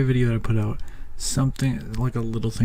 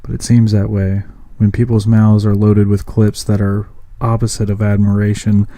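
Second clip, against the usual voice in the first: rhythmic, projected poetry recital; spoken-word poem; 8 dB louder than usual. Acted. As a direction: deliver it as a steady, emphatic slam piece. Performs own spoken-word piece.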